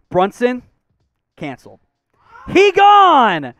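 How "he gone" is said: loud, high call, then drawn out; excited announcement. Real, not acted. Host breaking news that Brunson leaves the Mavs, excited call.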